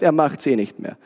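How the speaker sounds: leicht angedeuteter Großvater, tiefe Stimme; ruhiger Opa, nachgeahmt. Retold or imitated. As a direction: den gelassenen Opa mit gesenkter Stimme zitieren. Zitiert ruhigen, gläubigen Opa. (ruhig und tief wie der Opa)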